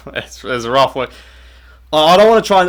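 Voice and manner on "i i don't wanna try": usual speaking voice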